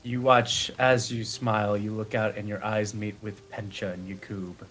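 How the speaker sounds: ordinary talk, nothing marked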